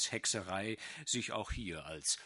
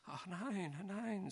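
meek denial; 5 semitones higher, 7 dB quieter, slower than usual. A narrator's reading. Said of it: "sagte Spiegel".

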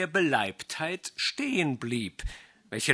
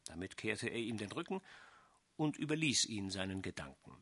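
usual speaking voice

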